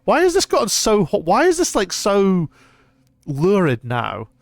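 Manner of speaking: high, loud shocked outburst; flustered, can't believe it; shocked disbelief. Real, not acted. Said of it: "What fuck?".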